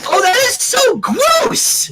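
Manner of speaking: high, slow, drawn-out howl; giddy gross-out howl; howling disgust. Acted. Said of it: "Pinky howled".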